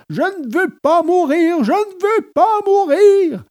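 high-pitched desperate wail; shrieked, high, pleading wail; howling in terror. A narrator's reading. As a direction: howl it like a puppet fighting for its life. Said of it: "en hurlant".

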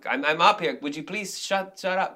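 high, loud, exasperated stage diva outburst; Hedwig snapping at the audience. Retold or imitated. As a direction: round on a chattering front row mid-show. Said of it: "Hedwig talks to the audience and be like".

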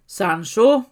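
a loud call with a rising end. Acted. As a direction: call his name out across the dark. Calling out to his companion. (ropar högt på Sancho)

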